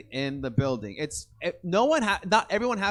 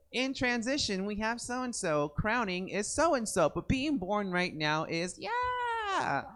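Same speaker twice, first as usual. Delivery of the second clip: announcer demo; 5 semitones higher, 5 dB quieter, slower than usual. Shown as a demonstration. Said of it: "it's gonna sound like".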